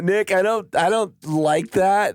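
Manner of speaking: director voice. Retold or imitated. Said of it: "It would have been like".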